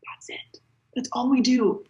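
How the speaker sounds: hushed, intense whisper on the punchline; whisper-yell it like a big secret. Real, not acted. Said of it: "It's the whisper yelling again for me".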